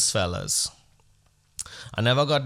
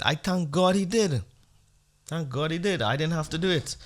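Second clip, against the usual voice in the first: high, emphatic thanks; mock grateful; 7 semitones higher than usual. Husband telling his wife, high and relieved. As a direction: insist with relief. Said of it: "I said".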